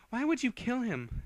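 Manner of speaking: high, pained cry; grieving plea; tearful accusation. Acted. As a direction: demand through tears.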